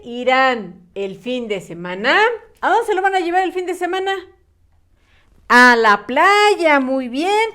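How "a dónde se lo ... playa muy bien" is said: excited question and praise. Real, not acted. Teacher quizzing her class, excited quick question. Teacher asks, then echoes answer.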